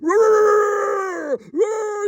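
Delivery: high, loud mock beast roars; animal roar. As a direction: roar like a wild animal to scare a friend. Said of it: "and I'm like".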